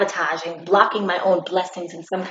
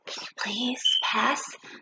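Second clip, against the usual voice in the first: soft, whispery put-on girlfriend voice; girlfriend's voice; 10 dB quieter, slower than usual. Girlfriend greeting boyfriend, soft whispery put-on voice. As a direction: breathe it like a sleepy girlfriend. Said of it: "And she's like".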